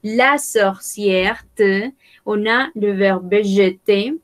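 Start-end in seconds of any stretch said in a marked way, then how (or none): 0.0-1.5 very slow repeat. Shown as a demonstration. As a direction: repeat "la sorcière" very slowly. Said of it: "teacher repeats new word". (repetición muy lenta)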